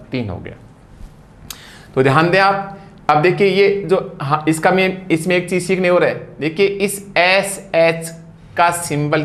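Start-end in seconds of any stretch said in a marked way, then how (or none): none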